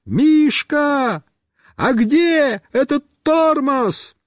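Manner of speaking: high, stretched-out call; slow high-pitched yell; shouting. A narrator's reading. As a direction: holler the question across the yard. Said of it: "Я кричу".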